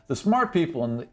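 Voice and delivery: normal talking voice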